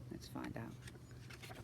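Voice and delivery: soft unvoiced whisper. Storyteller whispering to the children, hushed. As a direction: breathe it like a secret.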